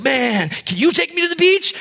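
high pleading ask; pleading dude. Retold or imitated. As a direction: beg for a ride. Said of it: "so he's like".

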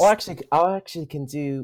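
put-on British voice; amateur British accent attempt. Shown as a demonstration. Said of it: "give me a British accent".

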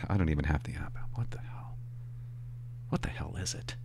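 unvoiced muttered aside to himself; whispered aside. Real, not acted. Comedian realizing mid-promo he has no app, whispering to himself. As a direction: realize it quietly, half to yourself.

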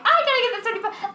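high, fast, laughing indignation; mock outrage over a small sum; indignant. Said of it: "I felt so indignant".

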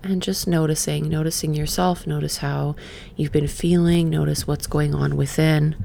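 quiet meditation voice, a touch breathy. Acted. Leading viewers to check in, soft meditation voice. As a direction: invite them to notice, softly. Guided noticing, softer.

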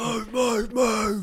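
high-pitched pretend battle calls; mock team orders. Imagined paintball teammate ordering the team forward, mock urgent calling. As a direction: urge the squad on in mock urgency.

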